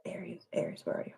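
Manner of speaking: softly whispered calling for a card; whisper-call to the missing card. Real, not acted. Host hunts her card deck, whispering a call.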